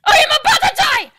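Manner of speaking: frantic high yell of near-death panic; panicked shout. Real, not acted. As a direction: scream it, sure you're about to die. Creeper explodes nearby.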